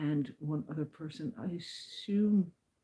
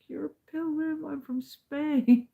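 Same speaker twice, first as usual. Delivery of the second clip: put-on high, eager young woman; excited Paula; 11 semitones higher, 8 dB louder, slower than usual. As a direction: squeal it with delight. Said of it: "she goes".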